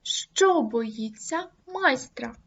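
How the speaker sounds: «Що боїться майстра?» повільно, з наголосом на «Що»; вирізни питальне слово голосом; logical stress. Shown as a demonstration. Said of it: "Простежте, як змінюється зміст речення". (логічний наголос на питанні)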